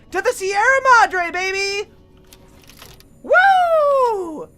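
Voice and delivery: cheering as the trip begins; hyped cheer. Real, not acted. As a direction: celebrate the getaway. Hyped leaving for DLC.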